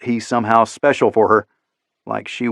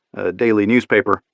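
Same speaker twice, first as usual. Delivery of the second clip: ordinary delivery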